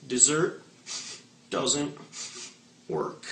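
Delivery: slow, halting mock-hurt pout; mock crushed waitress. Waitress pouting at the customer, a mock sad drag. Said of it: "they always look at me and they go".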